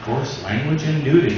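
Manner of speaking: low, slow, announcer-style warning. Retold or imitated. Comic reading the TV warning to the crowd, in a low announcer voice. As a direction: intone it like a TV content advisory. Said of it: "you get that".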